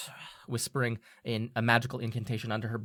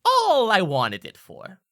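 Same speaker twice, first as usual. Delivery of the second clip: slow and sly, a bit louder; smirking; 6 semitones higher, 6 dB louder, slower than usual. Acted. Selty smirking to the party about her revenge. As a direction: let the smirk into the words. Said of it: "looks away for a second and smirks".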